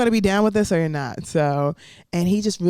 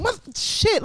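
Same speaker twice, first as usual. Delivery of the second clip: baffled; 14 semitones higher than usual. Retold or imitated. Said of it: "and I'm like".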